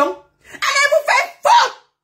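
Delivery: very high, drawn-out yell; cri de démonstration. Shown as a demonstration. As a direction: scream it slowly as an example. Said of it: "Là, c'est l'intonation". (hurlement aigu et traînant)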